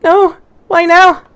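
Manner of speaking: put-on high, slow falsetto wail of a flustered girl; falsetto schoolgirl panic. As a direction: cry it out as the embarrassed girl. Girl panics over her skirt.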